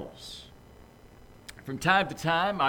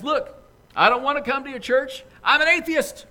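louder, higher, fed-up refusal; mad; 10 semitones higher, 8 dB louder than usual. Acted. Boss finally snapping at the lady, louder and fed up. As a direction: put his foot down, exasperated. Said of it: "is getting rather mad".

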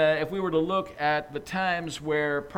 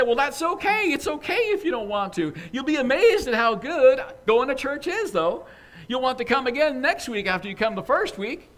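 much higher, bright pushy church lady voice; eager church lady; 9 semitones higher, 6 dB louder than usual. Acted. Church lady urging her boss to come, high and eager. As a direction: press the invitation on him, chirpy.

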